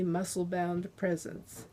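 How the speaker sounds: ordinary delivery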